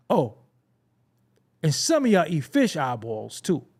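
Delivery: ordinary talk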